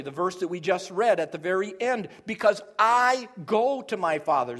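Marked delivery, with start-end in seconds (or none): 2.3-4.6 "I" punched loud, the rest following on; strong stress on "I"; emphatic I. Shown as a demonstration. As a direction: hit "I" hard and hold it. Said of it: "That's emphatic".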